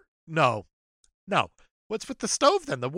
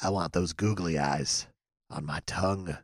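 much lower, soft creepy voice; heavy breathing phone whisper; 14 semitones lower, 7 dB quieter than usual. Acted. Repeated low and creepy.

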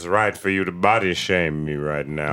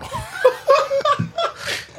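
high squealing laugh. Real, not acted. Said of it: "That was my one laugh".

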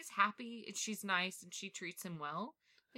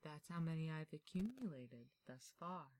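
spooky butler; 7 dB quieter than usual. Acted. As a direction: hush it like a eerie butler showing his house. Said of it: "sounds like a spooky butler".

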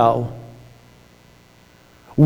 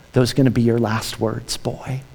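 much lower and softer, a menacing sneer; sinister mocking Satan; 9 semitones lower, 8 dB quieter than usual. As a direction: taunt him quietly like the devil. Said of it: "Satan would mock him with".

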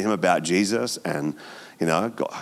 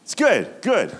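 higher, hammed-up eager yeah yeah; mock enthusiasm; 13 semitones higher, 3 dB louder than usual. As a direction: parody the crowd's easy cheer. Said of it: "some of you are like".

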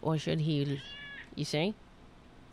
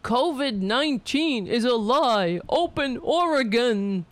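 mocking sign reader; 6 semitones higher, 4 dB louder than usual. Reading a protest sign mockingly.

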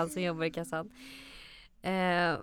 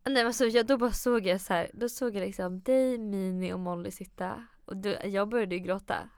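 ordinary talk

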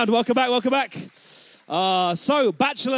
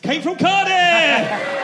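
excited announcement; 8 semitones higher, 5 dB louder, much slower than usual. Acted. Host repeats the contestant's name.

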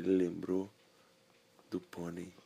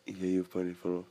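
ordinary delivery, nothing marked

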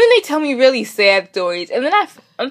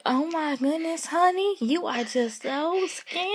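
slow, higher mock cooing of an older lady; older person cooing; 7 semitones higher, 8 dB quieter, slower than usual. Older people fussing over a skinny girl, mock cooing. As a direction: lightly imitate a fussing old lady, slow and sing-song. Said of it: "when older people come up to you and be like".

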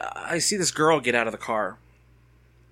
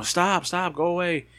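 retold scream; 7 semitones higher than usual. Passenger screaming at the man blocking the car, mildly imitated. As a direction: imitate her screaming, pitch up without full volume. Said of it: "you know, she's screaming".

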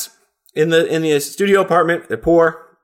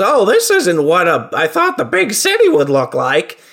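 high, hammy turkey character voice; Fred Travellini; 4 semitones higher, 3 dB louder than usual. Acted. Turkey steps off the bus in the big city, hammy high voice. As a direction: play a naive newcomer, pitched up. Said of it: "thank you for that voice".

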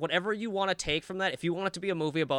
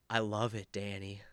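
low, hushed movie-villain voice; creepy fatherly reply; 8 semitones lower, 9 dB quieter than usual. Acted. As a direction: imitate an unhinged father, very softly. Imitating creepy father answering his son.

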